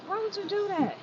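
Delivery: high-pitched disbelieving quote; high mock outrage at mom; incredulous retort. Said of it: "And I was like".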